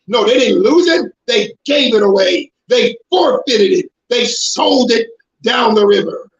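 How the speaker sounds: ordinary talk, nothing marked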